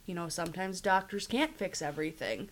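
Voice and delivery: normal talking voice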